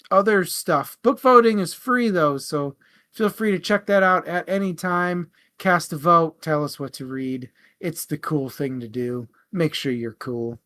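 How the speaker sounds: ordinary talk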